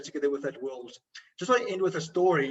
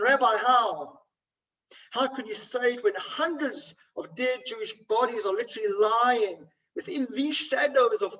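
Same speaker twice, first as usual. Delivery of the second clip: raised pitch, retold impassioned protest; anguished incredulous challenge; 5 semitones higher than usual. Camp inmate challenging the rabbi over his blessing, retold with anguished incredulity. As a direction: plead for an answer in disbelief, voice pitched up.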